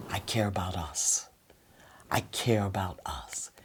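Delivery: soft whisper, sincere; whispered I care. Shown as a demonstration. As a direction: breathe it gently to your partner. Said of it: "you wanna speak slow".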